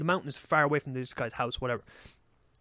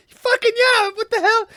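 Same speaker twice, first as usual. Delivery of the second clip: much higher and louder excited whoop; thrilled yes; 9 dB louder, slower than usual. Retold or imitated. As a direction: jump at the offer, thrilled. Offered to see a bear.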